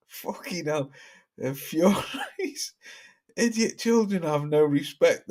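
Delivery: ordinary delivery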